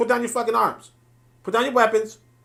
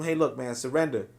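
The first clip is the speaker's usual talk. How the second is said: calm demand to surrender; 8 semitones lower, 7 dB quieter than usual. Retold or imitated. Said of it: "and you say to them".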